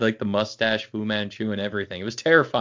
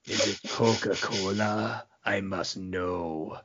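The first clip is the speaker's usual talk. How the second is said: evil sinister voice; slower than usual. Retold or imitated. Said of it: "he has the most evil, sinister voice".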